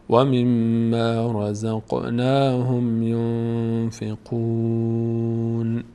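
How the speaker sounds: slow melodic chant joining the verses; joined recitation. Shown as a demonstration. Teacher shows joined verses, slow melodic chant. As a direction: sing the verses together slowly.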